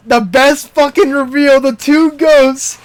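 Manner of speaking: loud, high-pitched, thrilled retelling; excited gushing. Real, not acted. Fan retelling the best reveal to friends, loudly excited. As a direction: belt out the big reveal, bowled over.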